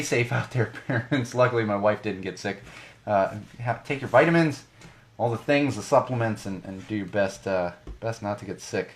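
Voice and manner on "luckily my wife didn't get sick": usual speaking voice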